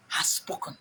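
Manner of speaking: breathy hushed whisper. Acted. Speaker mocking the word of God, whispered. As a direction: whisper it like a holy secret.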